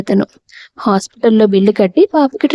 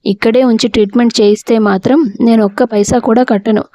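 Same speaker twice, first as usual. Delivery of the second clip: ordinary delivery, nothing marked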